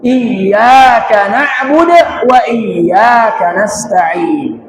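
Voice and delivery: slow recitation with iyyaka as "iy-yaa-ka"; drawn-out tajwid chant pressing iyyaka; pressed double y. Shown as a demonstration. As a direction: lean on the doubled y each time in iyyaka. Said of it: "agak ditekan".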